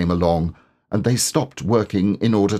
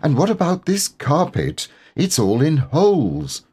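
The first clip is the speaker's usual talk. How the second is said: higher, fussy complaining motherly voice; fussing Kanga voice; 7 semitones higher than usual. Acted. Kanga fussing to Owl about his carpet, higher voice. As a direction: fret over the holes in the carpet.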